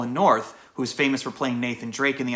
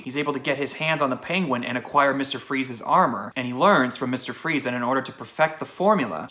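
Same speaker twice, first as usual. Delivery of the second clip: ordinary talk, nothing marked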